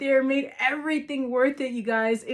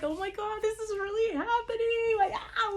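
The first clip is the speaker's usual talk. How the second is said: higher, breathless gasp of disbelief; starstruck disbelief; 10 semitones higher, 4 dB quieter than usual. Retold or imitated. Fan recalling seeing Vince wrestle live, awed and breathless. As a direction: relive the moment you couldn't believe it. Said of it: "'cause I was like".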